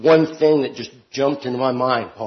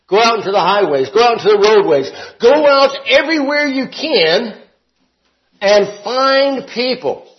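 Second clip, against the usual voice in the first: raised, emphatic preacher's command; preaching; 8 semitones higher, 6 dB louder than usual. Acted. Preacher voicing the king's order to gather guests, preaching. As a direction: proclaim the order with rising force.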